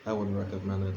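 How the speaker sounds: ordinary delivery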